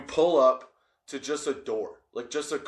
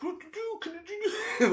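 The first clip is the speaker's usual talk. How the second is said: squeaky high panicked babble, much softer; mock panic; 4 dB quieter than usual. Hiding in the truck, mock-panicked high squeak. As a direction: play a kid losing it in a whisper-squeak. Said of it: "freaking the fuck out".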